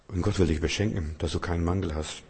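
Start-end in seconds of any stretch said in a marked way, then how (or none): none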